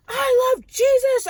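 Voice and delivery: loud, high, drawn-out angry declaration; pissed-off defiant shout. Retold or imitated. Dreamer answering the cursing woman, defiant shout. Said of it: "I was starting to get pissed".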